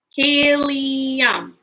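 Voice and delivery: HELIUM drawn out in three beats; broken into syllables. Shown as a demonstration. Teacher helping children count syllables, Helium drawn out. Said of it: "how many syllables does helium have".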